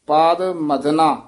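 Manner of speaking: slow ਪਾਦ ਮਦਨਾ with ਮਦਨਾ as one word; joined ਮਦਨਾ. Shown as a demonstration. Teacher demonstrating tradition's reading, slow joined ਮਦਨਾ. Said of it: "ਮਦਨਾ ਪਾਠ".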